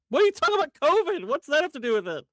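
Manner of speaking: loud, high-pitched incredulous outburst; mock incredulity. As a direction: throw back the question in exasperated disbelief. Mock disbelief at debate topic change.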